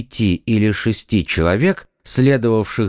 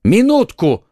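loud high command; sharp exclamation; 11 semitones higher, 5 dB louder than usual. Acted. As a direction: stop them at once. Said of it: "воскликнул Д'Артаньян". (громкий резкий окрик)

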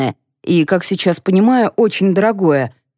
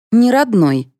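ordinary delivery

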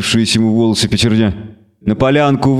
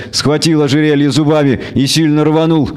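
ordinary delivery

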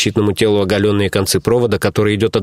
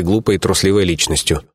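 ordinary delivery, nothing marked

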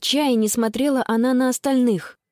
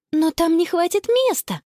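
high-pitched protest; exclaiming; 8 semitones higher than usual. A narrator's reading. Sister objecting there is no room in the tent, exclaiming. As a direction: object with a raised voice. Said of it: "воскликнула Конни".

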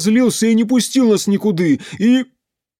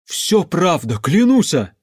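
raised, earnest oath; crying out; 4 semitones higher, 5 dB louder, slower than usual. A narrator's reading. Pyotr vouches before the crowd, crying out. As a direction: cry it out, swearing it is true. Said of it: "крикнул он".

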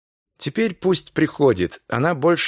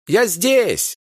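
cheerful shout; 7 semitones higher than usual. Acted. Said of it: "крикнула она".

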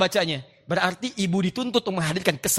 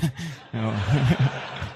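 soft laugh; 8 semitones lower, 3 dB quieter than usual. Real, not acted. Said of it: "Ini live ya".

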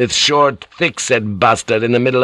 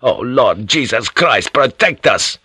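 high, indignant accusing rant; outraged tirade at the priests; enraged inward tirade; 6 semitones higher than usual. Acted. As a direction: pile up accusations in a raised pitch. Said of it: "he wanted to shout".

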